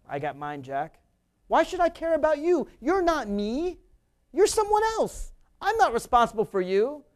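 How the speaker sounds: a callous voice, rising high on "You're someone else"; selfish Republican voice. Retold or imitated. Host voicing Republicans' selfish view, mocking and callous. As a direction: play the smug man who shrugs off everyone. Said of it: "Republicans' view".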